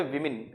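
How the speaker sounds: विमेन as WIM-in; short "i" in both syllables of विमेन; correct pronunciation. Shown as a demonstration. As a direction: say विमेन with "wim", not "woo". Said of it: "correct pronunciation होता है".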